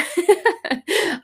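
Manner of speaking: loud, high burst of laughter; loud high giggle. Real, not acted. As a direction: break into a real laugh. Laughs mid-story.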